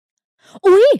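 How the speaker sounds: high, loud "อุ๊ย" of fright; startled shriek. A narrator's reading. Woman scooped up without warning, startled shriek. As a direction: yelp as she is suddenly lifted. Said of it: "เธอร้องเสียงหลงด้วยความตกใจ".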